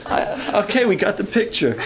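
said through a laugh. Real, not acted. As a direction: wrap it up still laughing. Laughing after skit.

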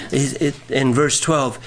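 ordinary talk

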